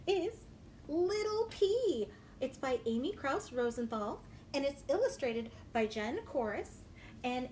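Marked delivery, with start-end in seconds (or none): 0.1-2.1 title announced. Acted.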